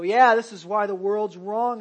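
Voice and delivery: loud, self-righteous mock churchgoer; smug mock quote. Preacher mimicking smug Christians to his congregation, loud and self-righteous. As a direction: belt it out like a smug know-it-all.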